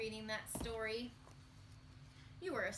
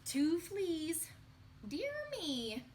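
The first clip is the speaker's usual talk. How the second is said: higher, fussy little exclamation; high playful voice; 7 semitones higher, 4 dB louder, much slower than usual. Acted. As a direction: squeak it like a fussy aunt.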